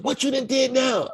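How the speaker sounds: high scolding mom; angry mother. Retold or imitated. Mother confronting her son at the station, high scolding.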